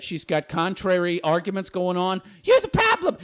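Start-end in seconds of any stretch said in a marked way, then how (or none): 2.4-3.2 high mocking inner voice. Her inner voice accusing her, high and sneering. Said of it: "voices speaking in her head".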